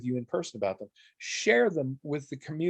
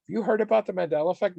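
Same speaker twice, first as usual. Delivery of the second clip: fast eager question; 6 semitones higher, much faster than usual. Retold or imitated. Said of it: "she's like".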